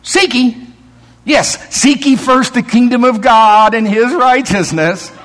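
playful scripture comeback; retort to the devil. Retold or imitated. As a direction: answer with a triumphant grin. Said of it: "Talking back to Michael".

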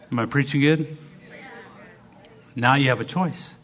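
usual speaking voice

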